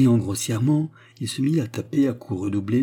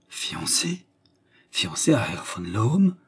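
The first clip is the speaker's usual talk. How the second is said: haggard, stunned; slower than usual. A narrator's reading. Suitor learning of the engagement, stunned. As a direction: repeat it in stunned disbelief. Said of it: "répéta Cloutz, hagard".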